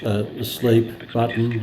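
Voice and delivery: ordinary delivery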